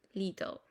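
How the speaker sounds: "Little" with a clear middle T; British "Little", like "li-tol"; British pronounced T. Shown as a demonstration. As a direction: sound the T fully in "Little". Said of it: "Ellos sí pronuncian la T".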